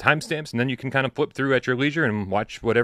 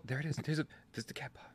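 much softer, half-voiced aside about the cat paw; hushed aside; 3 semitones higher, 16 dB quieter than usual. Real, not acted. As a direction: murmur it to yourself. Quiet aside to on-screen cat paw.

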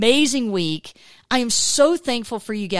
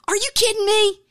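amazed disbelief; 11 semitones higher than usual. Retold or imitated. A woman in her car shrieking in happy disbelief at God.

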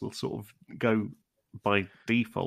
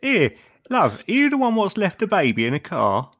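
higher, louder put-on Brit; calling out English shopkeeper; 9 semitones higher, 5 dB louder than usual. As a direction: shout across the shop. Improv shopkeeper calls out.

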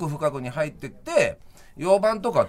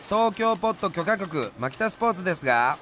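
customer service voice; 4 semitones higher than usual. Pretend. Answering the office phone.

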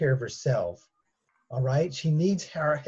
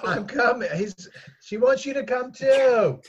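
high, carrying call; 10 semitones higher, 4 dB louder than usual. Acted. Daughter relaying her mother's demand, calling out. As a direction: call it out so it carries through the house.

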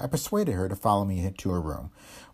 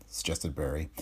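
ordinary delivery

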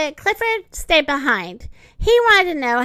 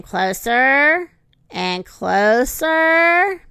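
slow suspense; much slower than usual. A narrator's reading. Suspense narration.

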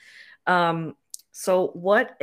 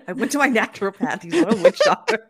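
loud laughing protest; 3 semitones higher, 9 dB louder than usual. Real, not acted. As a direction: laugh through it, louder.

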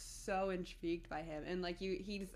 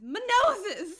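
higher and much louder, laughing; comic wail; 13 semitones higher, 14 dB louder than usual. Retold or imitated. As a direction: belt it out, hammed-up. Quoting a line, laughing.

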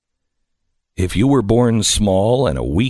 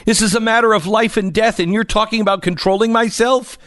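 fast, high-pitched outburst; acted yelling, pitched way up; indignant yell; 11 semitones higher, faster than usual. A narrator's reading. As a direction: rattle it out as an outraged child. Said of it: "yelled Fern".

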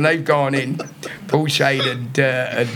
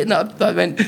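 ordinary talk

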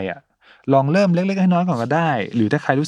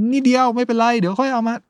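high, coaxing inner voice; whispering in your ear; 12 semitones higher than usual. Acted. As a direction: tempt yourself sweetly. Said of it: "คอยมากระซิบข้างหูว่า".

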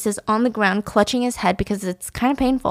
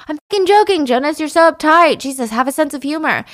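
high, loud mock outburst; exasperated protest; 7 semitones higher, 4 dB louder than usual. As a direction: scold him for not getting the joke. Acted outburst at uptight host.

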